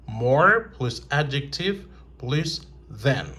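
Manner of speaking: slow dictation. Shown as a demonstration. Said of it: "dictates formula slowly".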